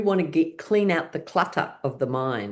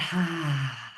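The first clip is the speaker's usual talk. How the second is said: long falling "haa" sigh; demonstrative relaxing exhale; breathing out; 4 dB quieter than usual. Shown as a demonstration. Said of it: "And breathing out".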